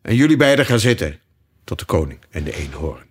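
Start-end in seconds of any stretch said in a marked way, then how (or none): 0.0-1.2 loud, higher-pitched order; bossy raised-voice instruction; commanding lion. A narrator's reading. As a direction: tell them off with authority. Lion orders king and unicorn.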